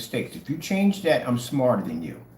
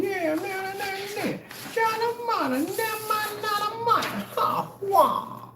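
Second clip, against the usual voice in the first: high-pitched gibberish rant; mocking high-pitched meltdown; mock snapping out; 3 dB quieter, much slower than usual. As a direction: imitate him going off in a squeal. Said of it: "him snapping out and going off".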